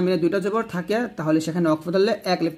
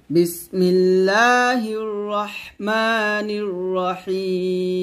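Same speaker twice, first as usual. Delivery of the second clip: slow melodic tilawat; Quran recitation; much slower than usual. Acted. Recites taawwuz and bismillah. (ধীর সুরেলা তিলাওয়াত)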